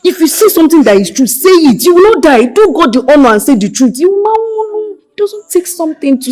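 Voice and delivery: loud, high-pitched insistence; forceful urging. Acted. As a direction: demand the truth at full voice. Urging someone to tell truth.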